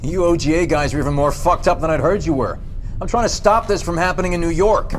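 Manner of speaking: heated, rising shout; angry. Acted. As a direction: snap back in fury. Angry at interrogators' accusation.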